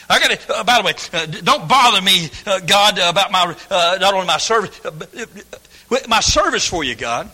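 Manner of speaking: fumbling mock prayer; mock hesitant prayer. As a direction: stammer excuses to God. Voicing excuse prayer.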